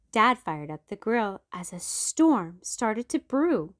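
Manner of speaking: usual speaking voice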